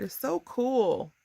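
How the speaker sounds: real excitement, slow and lifted; delighted gushing. Real, not acted. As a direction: stretch it out, thrilled. Gushing over her card.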